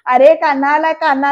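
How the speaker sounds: loud, excited retold call. Chawl neighbours announce Kanha has come, calling out. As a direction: call the news out to everyone.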